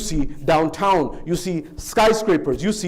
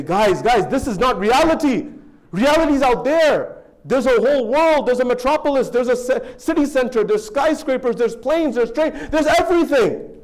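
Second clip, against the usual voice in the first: urgent plea; 6 semitones higher than usual. Acted. Returnee begs friends in the cave, urgent. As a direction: plead with them to see it.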